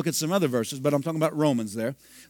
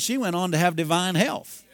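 usual speaking voice

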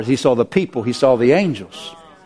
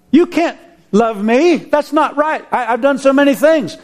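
high, pained protest; anguished protest; 10 semitones higher than usual. Retold or imitated. Sinful man protesting to Jesus, anguished and high. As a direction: plead that you are unworthy.

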